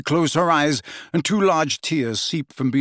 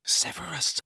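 whispered; 4 semitones higher, 7 dB quieter than usual. A narrator's reading. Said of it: "she whispered".

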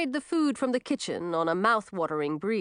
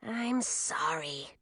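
slow, much softer apology; whispered; 3 semitones lower, 7 dB quieter, much slower than usual. Acted. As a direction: hush it so only Millie hears. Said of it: "Dyspepsia whispered to Millie".

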